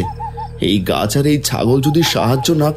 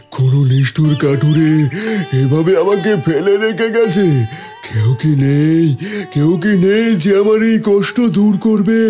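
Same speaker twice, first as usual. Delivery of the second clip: higher, aching voice of a wounded tree; talking tree; 5 semitones higher than usual. Acted. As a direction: moan out in pain as a tree. Wounded tree cries for help.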